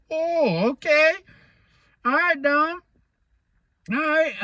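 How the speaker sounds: high, laughing mock surrender; mock backing down. Vlogger backs off from Dom in a high laughing mock surrender. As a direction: squeak out a nervous okay, pretending to back down. Laughing reply to Dom's threat.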